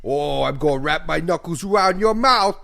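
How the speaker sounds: mock New York threat, loud and high; cartoon New York tough talking big; New Yorker accent. New Yorker threatening a fight, hammed-up loud New York accent. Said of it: "What is that accent?".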